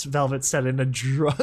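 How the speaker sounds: ordinary delivery, nothing marked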